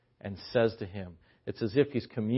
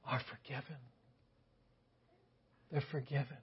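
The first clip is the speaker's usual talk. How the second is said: whispering; 2 semitones higher, 11 dB quieter than usual. Acted. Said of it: "almost like he's whispering to her ear".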